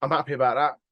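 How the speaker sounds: ordinary talk, nothing marked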